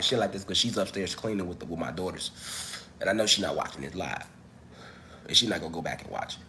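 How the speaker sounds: low, quiet, confidential undertone; hushed voice. Real, not acted. Streamer confiding while his partner is upstairs, hushed. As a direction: drop it right down so it stays between us. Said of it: "So I can talk like this".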